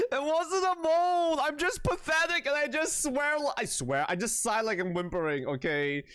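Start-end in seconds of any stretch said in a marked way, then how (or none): 0.1-4.1 much higher, half laughing, half crying protest; laughing whine. Real, not acted. Streamer denies the moan, laughing and whining. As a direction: whine through the giggles, mortified. Embarrassed defending her sighs.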